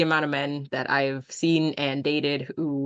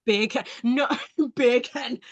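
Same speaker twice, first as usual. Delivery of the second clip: slow, amused Jamaican-accented "bacon"; 5 semitones higher, slower than usual. Shown as a demonstration. Friend testing the bacon trick, Jamaican accent. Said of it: "like a Jamaican person saying bacon".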